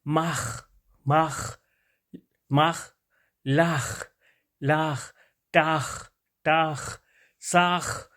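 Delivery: each word from "ماخ" to "راخ" separate, ending in throaty kh; kh sound drill. Shown as a demonstration. Teacher shows how kh is produced, drilling "ماخ" through "راخ". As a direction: drill "ماخ، لاخ، ٹاخ" with the final kh drawn out. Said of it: "نیچے کیسے خے کی آواز نکالتے ہیں".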